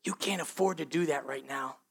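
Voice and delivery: hushed, low whisper in the ear; insinuating hush of a tempter; enemy's whisper. Acted. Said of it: "He's gonna whisper in your ear like".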